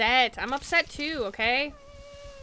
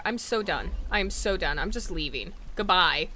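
ordinary talk

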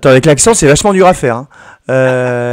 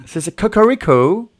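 cocorico said higher in a put-on English accent; mock English-accented cocorico; 7 semitones higher than usual. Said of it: "Et non". (cocorico avec un accent anglais forcé)

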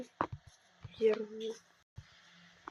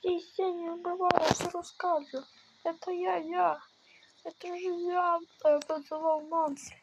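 high-pitched begging confession; play-acted pleading captive; 5 semitones higher than usual. (високий голос наляканого полоненого, понарошку)

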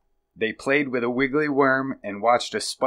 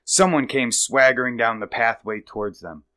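usual speaking voice

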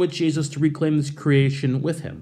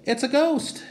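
much higher, squeaky alarmed cry; frightened disciples; 11 semitones higher than usual. Retold or imitated. Disciples in the boat seeing Jesus, a high frightened cry. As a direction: yelp it like a spooked fisherman. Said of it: "And they're like".

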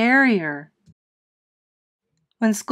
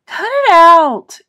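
high, loud, drawn-out yell; a boy yelling back at the bullies; 9 semitones higher, 11 dB louder than usual. Acted. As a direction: shout them off, fed up. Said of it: "yelled Frankie".

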